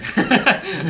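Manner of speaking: loud, high laughing burst; loud laugh of surprise; burst of laughter. Real, not acted. Surprised at being on TikTok.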